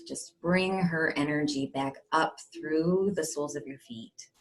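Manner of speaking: hushed, unhurried guide into calm; guided meditation. Acted. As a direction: settle them gently, leaving space between phrases.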